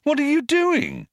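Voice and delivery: high-pitched, incredulous question; astonished exclamation. A narrator's reading. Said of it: "he exclaimed".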